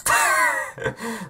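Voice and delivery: sudden high amused laugh; real burst of laughter. Real, not acted. Laughing at Siri calling her the President, a real laugh. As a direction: crack up at the answer.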